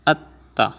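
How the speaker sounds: doubled t in atta. Shown as a demonstration. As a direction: hold the t then release into a. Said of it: "at the end you will say atta".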